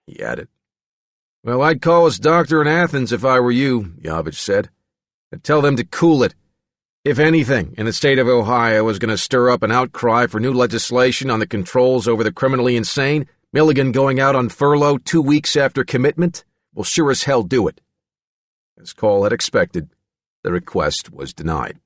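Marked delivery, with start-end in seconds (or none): 1.4-3.9 louder, higher, firm advice; forceful. Acted. Official pushing back on phone. 5.3-17.8 louder, higher, insistent; forceful warning. Acted. Official warns of outcry.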